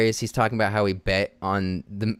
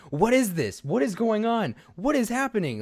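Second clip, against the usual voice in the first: mock panic; 11 semitones higher than usual.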